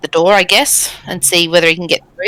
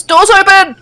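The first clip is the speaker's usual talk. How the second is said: high, loud in-character yell; raised, high-pitched shout; Wolf yelling; 6 dB louder, slower than usual. Acted. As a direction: yell it out as an alert to the party. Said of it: "Wolf yells".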